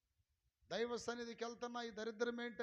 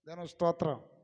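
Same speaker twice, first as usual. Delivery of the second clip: loud praise call; 2 semitones lower, 15 dB louder than usual. Real, not acted. Preacher calling praise to the congregation, loud.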